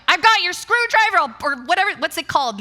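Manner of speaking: high, loud frantic retelling; hammed-up agitated yell; worked-up anger. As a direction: blurt it loudly, all riled up. Acting out her anger frenzy.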